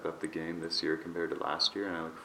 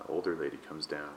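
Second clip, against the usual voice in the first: normal talking voice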